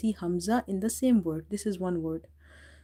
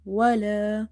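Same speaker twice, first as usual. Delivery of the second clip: ولا with its final "aa" held long; stretched vowel; 4 dB louder, slower than usual. Shown as a demonstration. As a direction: stretch the alif at the end of ولا. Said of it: "So we read it by stretching".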